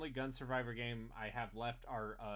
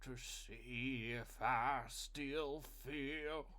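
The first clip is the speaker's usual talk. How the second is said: mock emo voice; 4 dB quieter, much slower than usual. Mock emo self-pity line.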